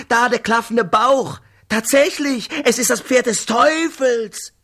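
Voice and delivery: loud, high, awestruck visionary calling out; feverish prophet. Acted. Mafaka shows the drunk Brafane the devil's horse, loud and awestruck.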